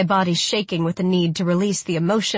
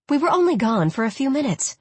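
much higher, pleading protest; 7 semitones higher than usual. Acted. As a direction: protest your innocence. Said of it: "I protested".

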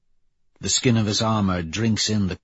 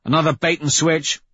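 higher-pitched, mildly angry complaint; snapped, annoyed; 6 semitones higher, 3 dB louder than usual. A narrator's reading. Clown dismissing the news report, snapping. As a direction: snap it out with some scorn. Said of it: "Clown snaps".

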